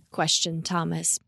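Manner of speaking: plain reading voice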